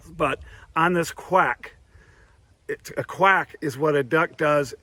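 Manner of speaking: ordinary delivery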